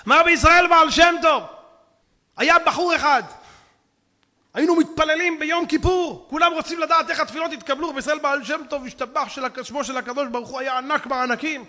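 high, loud sermon voice; fiery preaching. Acted. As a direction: preach it out to the crowd. Preaching at full force.

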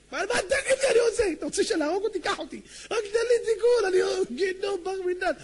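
very high, loud crying appeal; hammed-up sobbing penitent; wailing plea. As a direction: beg desperately in a tearful shriek. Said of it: "כולו בוכה".

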